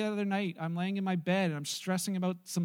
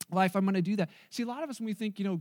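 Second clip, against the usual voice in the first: ordinary talk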